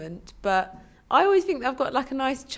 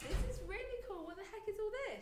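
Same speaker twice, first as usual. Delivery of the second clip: excited; 11 semitones higher, 15 dB quieter than usual. Real, not acted. First seeing the recording setup.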